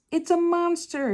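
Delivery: little fish sees the monster, shocked; shocked gasp. A narrator's reading. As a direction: blurt it out in fright. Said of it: "he gasped".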